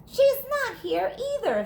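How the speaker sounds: a lightly voiced pondering dog; hint of a puzzled pup; dog thinking. A narrator's reading. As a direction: wonder aloud as Marley. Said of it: "thought Marley".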